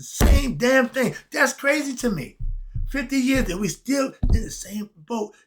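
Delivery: rising exasperation at no progress; exasperated outburst. Real, not acted. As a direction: blurt it out in disbelief. Outraged at fifty years unchanged.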